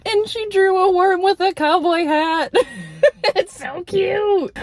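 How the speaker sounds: high, laughing through the words; laughing and squealing. Real, not acted. Describing Becca's worm drawing, laughing high. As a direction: giggle it out, delighted.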